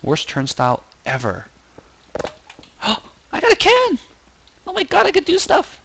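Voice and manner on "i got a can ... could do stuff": high-pitched thrilled squeal. Real, not acted. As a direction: squeal with delight over a tiny find. Finds a can in game.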